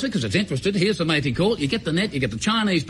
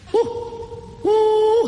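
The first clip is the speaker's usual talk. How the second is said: high hoot, the first one breathy; the beast's answer, nearer now; bunyip reply coming closer; 14 semitones higher than usual. Retold or imitated. Hunter imitating the bunyip's nearer answer, high hoot. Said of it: "And I heard".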